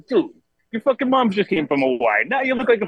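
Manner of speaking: high mock scolding rant; hammed-up uncle tirade. Uncle scolds the nephew about his mom and Hawaii, high mock tirade. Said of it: "He goes".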